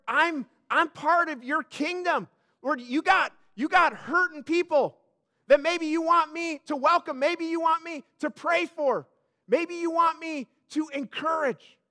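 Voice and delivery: rising revival-preacher energy; preaching at full force. Acted. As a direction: drive it home to the congregation. Preacher building to a climax.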